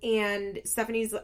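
ordinary talk

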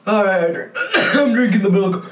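in real pain from the heat; mouth burning from spicy food. Real, not acted. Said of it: "it's burning everywhere".

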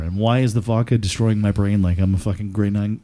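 ordinary talk

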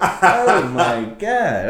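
slow, laughing oh my God; laughing disbelief. Real, not acted. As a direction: drag it out through a laugh. Laughing at lawyer's words.

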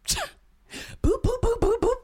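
high, loud, rhythmic "boop" noises; punchy run of high boop sound effects; imitated boops. Storyteller acting out going down the steps, bouncy high boops.

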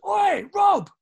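loud, high-pitched hail; big friendly "oi" holler; calling out. Retold or imitated. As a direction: shout to a mate across the crowd. Calling out across a festival.

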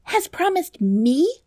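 high, slow shocked question, rising at the end; incredulous interruption. Acted. Princess interrupts the dwarf about the promise, high incredulous question. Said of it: "interrupted the princess".

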